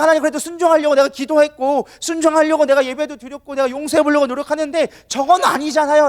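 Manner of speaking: high-pitched, pleading indignation; angry complaint. Acted. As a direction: argue with God without letting up. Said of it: "끊임없이 화를 내고 있는". (화내며 끊임없이 따지는 말투)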